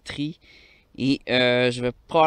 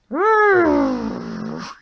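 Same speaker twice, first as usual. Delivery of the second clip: loud high-pitched laugh; high burst of laughter; 12 semitones higher, 3 dB louder than usual. Real, not acted. Big laugh.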